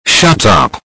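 shouted; loud shut up. Acted. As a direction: yell it. Yelled reply in chat.

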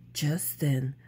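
ordinary delivery

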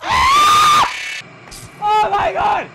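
very high, loud shriek; terrified scream. Real, not acted. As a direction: shriek in real terror. Jump scare.

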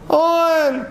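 high-pitched call through a window. Acted. Friends summoning On from the window, calling out. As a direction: call his name up high, to carry. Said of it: "צעק לו מהחלון".

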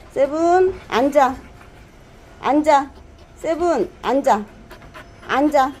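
loud, high repeated commands; talking to a dog. Real, not acted. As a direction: drill the dog firmly on sit.

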